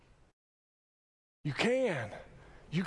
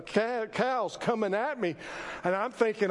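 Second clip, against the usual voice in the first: ordinary delivery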